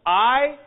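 I drawn out, as in "height"; pronunciation of epsilon iota. Shown as a demonstration. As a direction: say I as a long, rising glide. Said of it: "which we'll pronounce".

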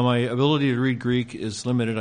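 ordinary talk, nothing marked

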